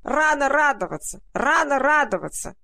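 high, loud cawing crow voice. Acted. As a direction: caw it out at full throat. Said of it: "каркала она во всё горло".

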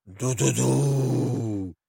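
low, soft, drawn-out toot; imitated steamship horn. A narrator's reading. Boy playing at being a steamship, long hoot. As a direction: hoot slowly like a ship's horn. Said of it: "и кричал он".

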